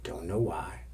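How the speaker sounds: ordinary delivery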